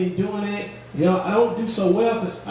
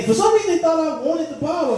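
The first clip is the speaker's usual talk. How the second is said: exasperated; 9 semitones higher than usual. Real, not acted. Building comic frustration.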